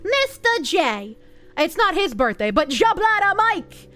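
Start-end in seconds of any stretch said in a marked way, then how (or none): 0.0-1.2 Boston accent, slow and drawn out; higher, stretched-out Boston voice. Shown as a demonstration. Streamer obliges a viewer's birthday request, Boston accent. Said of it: "in your Boston voice". 2.7-3.9 loud-ish, slow Boston accent, pitched up. Shown as a demonstration. Streamer shouts out the donor by name, Boston accent. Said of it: "in your Boston voice".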